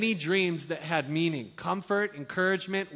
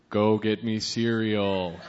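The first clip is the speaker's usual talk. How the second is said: slow, low voice of God; 8 semitones lower, 3 dB louder, slower than usual. Retold or imitated. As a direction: give a command as God, slowly. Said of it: "I've been working on my God impression".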